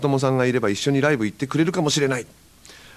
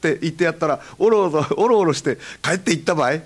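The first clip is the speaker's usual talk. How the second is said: higher Hakata brag with laughter; boastful Hakata; 6 semitones higher than usual. Retold or imitated. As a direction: brag to a friend in Hakata dialect. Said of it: "いつもの散歩仲間のようで". (得意げな博多弁)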